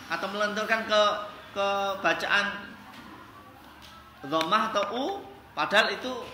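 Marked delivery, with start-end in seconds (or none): none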